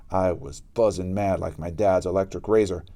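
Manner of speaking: usual speaking voice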